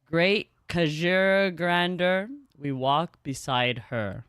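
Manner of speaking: slow solemn reading of a hymn-like passage; story narration. Acted. Streamer reading the game's story to chat, slow and solemn. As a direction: read it out like a slow reverent litany.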